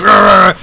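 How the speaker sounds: loud, high imitation growl; exaggerated wordless rage noise; mock angry growl. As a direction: growl like a furious loser, hammed up. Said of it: "he gets so mad".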